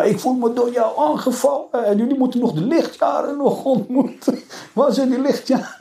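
high, mocking imitation of a complaining man; mocking Jansen. Mocking the Arabist on TV in a high, whiny voice. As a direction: squeak his words back high to mock him. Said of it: "En hij zegt, ja".

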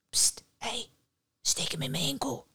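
hushed psst from above; furtive whisper. A narrator's reading. Hanged man secretly advises Nera, hushed. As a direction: get his attention softly, on the sly.

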